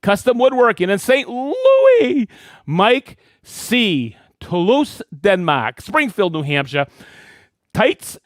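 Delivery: ordinary talk, nothing marked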